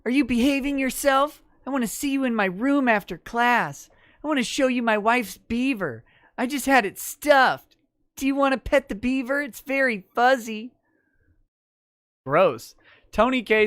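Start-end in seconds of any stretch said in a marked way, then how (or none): 0.0-10.7 creepy over-friendly teacher, pitched up; Mister Y. Acted. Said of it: "says in Mister Y voice".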